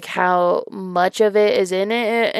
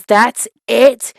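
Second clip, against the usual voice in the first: louder, put-on shout; a clearly acted, louder yell; 2 semitones higher, 7 dB louder than usual. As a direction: bark it out like the angry creep. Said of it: "He yells".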